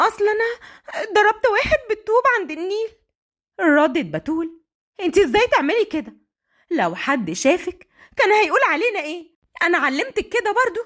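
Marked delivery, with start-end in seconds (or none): 0.1-2.9 high, fast, tearful confession; frightened, crying. A narrator's reading. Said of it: "وهي بتنهج". 5.0-10.8 scolding mother. Acted.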